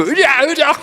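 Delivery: high, playful horse-riding cry; gleeful giddy-up. Retold or imitated. Imitating daughter riding dad as a horse, gleeful. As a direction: urge on a pretend horse, laughing. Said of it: "좋아하더라고요. 여기 귀를 잡고".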